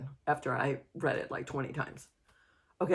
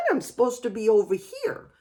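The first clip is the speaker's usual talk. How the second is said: normal talking voice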